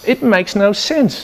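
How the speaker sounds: high, slow exasperated protest; baffled CEO. Acted. CEO objecting to panic buying, exasperated. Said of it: "that's what the C E O says".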